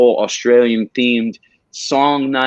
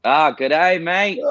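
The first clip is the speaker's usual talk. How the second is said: hammed-up Australian accent, higher and drawn out; a cheery Aussie greeting, pitched up; 6 semitones higher, much slower than usual. Shown as a demonstration. Asked by the host for his Australian accent, cheery hammed-up Aussie. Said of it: "let's hear your Australian accent".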